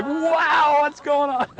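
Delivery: imitating a goggle-eyed onlooker; mock amazement. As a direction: squeal it in wide-eyed astonishment for laughs. Amused retelling of wide-eyed passenger.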